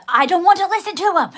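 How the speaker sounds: high, loud, fast sulky refusal; stubborn partner. Retold or imitated. Imitating her partner's stubborn sulk, high and loud. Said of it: "he's in that mode".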